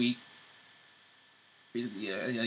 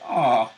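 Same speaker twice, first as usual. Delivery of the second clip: cooing aww; 12 dB louder than usual. Retold or imitated. Cooing at a sweet kiss.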